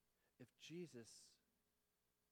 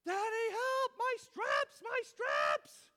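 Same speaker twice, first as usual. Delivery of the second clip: put-on loud, very high panicked child; little girl calling; 15 dB louder, much slower than usual. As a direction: imitate a small child yelling for dad. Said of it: "I have a kid".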